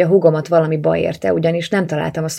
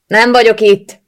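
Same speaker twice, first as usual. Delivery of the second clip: high, loud shout at the door; 5 semitones higher, 9 dB louder, slower than usual. A narrator's reading. Woman shuts out whoever is knocking, shouting. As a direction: shout to keep the visitor out. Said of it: "kiáltottam".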